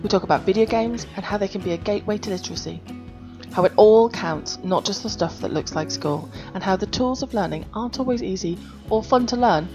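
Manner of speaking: bright host intro read; upbeat show opening; podcast intro. Acted. Podcast intro read over music.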